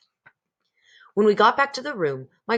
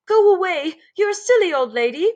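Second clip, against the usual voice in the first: shrill snooty woman; 12 semitones higher, 6 dB louder than usual. Acted. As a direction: sneer like a posh society wife. Mrs Jenkins shrill dismissal.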